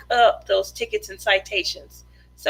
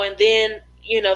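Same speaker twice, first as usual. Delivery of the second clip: normal talking voice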